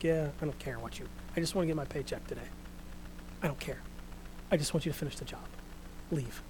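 quiet, uninterested dismissal; mock indifference. Acting out uninterested people brushing him off.

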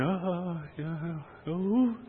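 soft, hesitant, rising groans; mock wavering. Preacher mimicking a hesitant answer, wavering. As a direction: waver reluctantly, voice lifting at the end. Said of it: "say it and waver and".

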